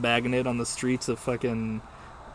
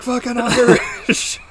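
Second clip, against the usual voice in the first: mock outburst; 11 semitones higher, 9 dB louder, slower than usual. Imitating a bigoted movie character.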